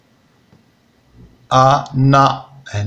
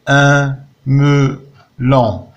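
Un melon said very slowly, syllable by syllable; syllables tapped out; 2 semitones higher, much slower than usual. Shown as a demonstration. Teacher splitting Un melon for the class, slow syllables. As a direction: stretch out each syllable of melon. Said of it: "Frappe les syllabes".

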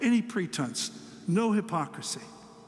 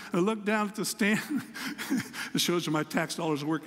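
ordinary delivery

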